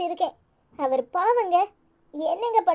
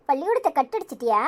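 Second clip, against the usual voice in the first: ordinary talk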